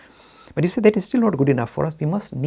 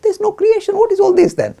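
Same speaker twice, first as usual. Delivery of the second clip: much higher, louder, astonished retort; incredulous objector; 8 dB louder than usual. Acted. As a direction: throw it back in high-pitched disbelief. Objector echoes answer in disbelief.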